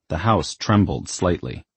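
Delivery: ordinary delivery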